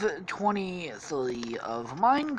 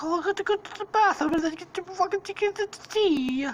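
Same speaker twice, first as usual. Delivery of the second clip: high, rushed cartoon Italian voice; mock Italian accent; 13 semitones higher, faster than usual. Stampy getting up for tea, hurried mock Italian accent. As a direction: rattle it off in high fake Italian. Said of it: "gonna be fucking Italian in this story".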